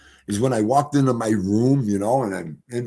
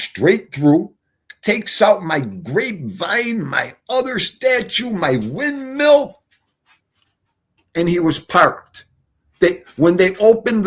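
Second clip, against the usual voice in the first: slow, rising, shouted list; excited; 8 semitones higher, 4 dB louder, slower than usual. Real, not acted. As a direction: build up each item higher. Excited retelling of crash damage.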